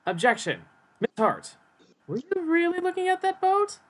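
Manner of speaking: lawyer objecting. Acted. Lawyer cross-examining Miss Hart about the boat, pointed challenge. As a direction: challenge the witness, pitch climbing high.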